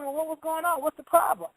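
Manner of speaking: lightly acted parent's questioning, voice lifted; concerned question.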